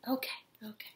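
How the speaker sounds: whispered, soothing the cat; whispering. Real, not acted. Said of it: "I'm whispering 'cause my roommate is sleeping".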